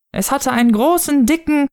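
put-on very high, thrilled child voice; eager child. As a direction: gush on until cut off.

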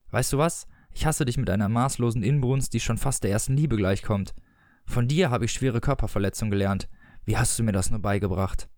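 ordinary delivery, nothing marked

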